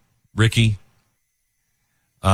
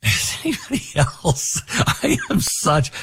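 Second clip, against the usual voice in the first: choked up; 8 semitones higher than usual. Real, not acted. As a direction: fight back real tears as you speak. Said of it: "a little bit of a tear".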